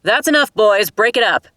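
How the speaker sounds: loud, high command to stop the fight; stern shout. A narrator's reading. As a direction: bark at the brawling boys to break it up. Guard breaks up a fight.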